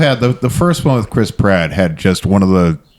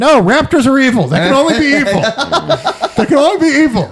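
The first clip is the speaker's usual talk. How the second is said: high-pitched, incredulous protest; comic indignation, pitched way up; mock outrage; 13 semitones higher than usual. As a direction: protest in a hammed-up, much higher voice. Acted protest at a film scene.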